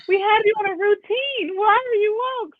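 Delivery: put-on mother telling off her baby who woke up; exasperated mom. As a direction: scold the wakeful baby in mock outrage.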